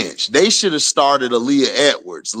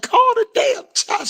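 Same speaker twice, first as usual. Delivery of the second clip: much higher squeaky mock yell; mock cuss-out. Streamer mimicking Angel cussing out Nola, shrill mock. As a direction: shriek it like a parody.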